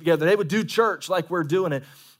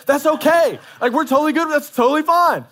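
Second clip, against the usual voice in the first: much higher, loud preaching; loud reassurance; 11 semitones higher, 8 dB louder than usual. Real, not acted. Pastor shouting assurance to hesitant members.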